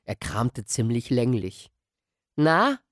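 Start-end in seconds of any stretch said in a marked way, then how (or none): 2.4-2.8 impatient prod. Acted. Impatient boy prodding old man.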